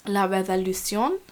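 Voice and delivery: French title in a rough French accent, lifted at the end; terrible French accent. Real, not acted. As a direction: attempt a French accent, ending on a rise. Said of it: "Excuse my terrible French accent".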